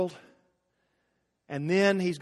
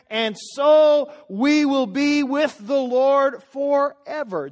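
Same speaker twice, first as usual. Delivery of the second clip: preached shout; 6 semitones higher, 5 dB louder, much slower than usual. Acted. Climax of the scripture reading.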